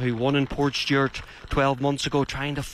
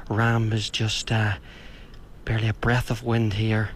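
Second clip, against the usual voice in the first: ordinary talk, nothing marked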